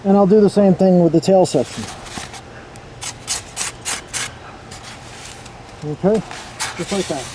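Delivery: speaking through a respirator mask; muffled through respirator. Real, not acted. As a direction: voice muffled behind a face mask. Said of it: "if I sound kinda muffled".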